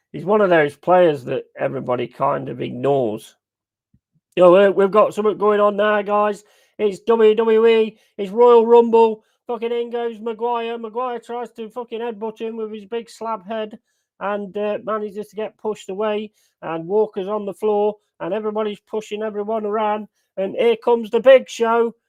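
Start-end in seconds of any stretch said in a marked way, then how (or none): 4.3-20.1 raised, hyped ring announcer voice; playful pro-wrestling commentary; mock wrestling announcer. 20.4-21.9 loud, hyped entrance call; louder mock entrance shout; announcer call.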